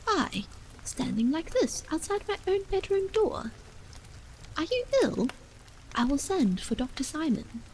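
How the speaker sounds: a worried wife pleading through a door. A narrator's reading.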